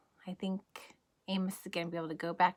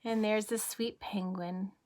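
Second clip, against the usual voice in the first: ordinary delivery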